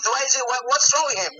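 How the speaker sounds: usual speaking voice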